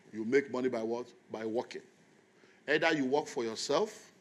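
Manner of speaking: normal talking voice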